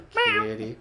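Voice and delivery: very high, sing-song call; calling the cat. Pretend. Roommate calling his cat from the next room, high squeaky. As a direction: squeak the word out like calling a pet. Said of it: "and he's just like".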